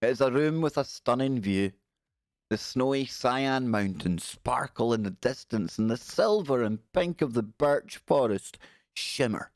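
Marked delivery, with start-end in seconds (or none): none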